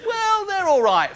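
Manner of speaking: high, slow grudging shrug; grudging approval. Retold or imitated. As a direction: say it like a shrugging fan. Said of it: "tapping into this".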